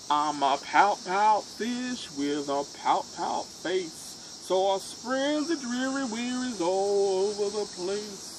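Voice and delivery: loud, slow, gloomy fish with a long sighing bloom; glum pout pout fish. Acted. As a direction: mope it out, then let the blooms sink and fade. Fish answers octopus glumly.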